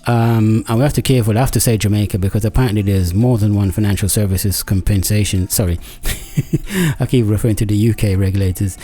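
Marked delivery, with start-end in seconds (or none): 5.8-6.9 short laugh. Real, not acted. Apologises after mixing up regulators.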